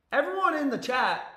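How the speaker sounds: high, loud call to the whole chat. Real, not acted. Streamer calling out loudly to his live chat. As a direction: shout out to get everyone's attention.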